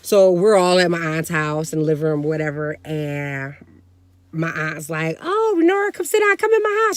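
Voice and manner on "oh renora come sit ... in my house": very high-pitched, insistent invitation; excited aunt imitation. Aunt urging her sister to come inside, mock-excited high voice. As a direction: call her over in a high voice. Aunt calling mom over, acted.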